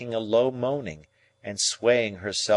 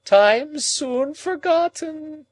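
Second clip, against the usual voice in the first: high, loud, very slow wail; moaning; 7 dB louder, much slower than usual. A narrator's reading. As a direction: moan it out even more desperately. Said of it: "moaned Miss Havisham".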